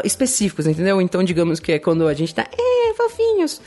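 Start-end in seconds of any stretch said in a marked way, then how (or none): 2.6-3.6 very high, slow cutesy squeak. Pretend. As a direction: stretch it out in a tiny baby-talk voice. Cutesy couple voice. (voz fininha e lenta de fofura)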